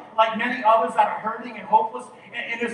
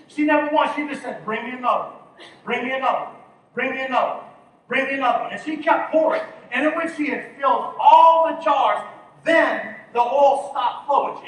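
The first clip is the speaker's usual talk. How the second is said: louder, higher sermon build; preaching at full force; 4 semitones higher, 8 dB louder than usual. Acted. Pastor telling the widow's story, full force. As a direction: drive the story home with force.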